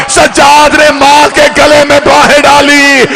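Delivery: loud, high, slow keening; loud lament; wailing recital. Acted. Wailing narration.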